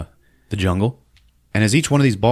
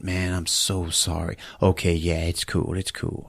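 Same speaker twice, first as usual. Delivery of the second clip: soft, fading apology; sheepish condolence; 3 semitones lower, 7 dB quieter than usual. Acted. Sheepish apology on bad news.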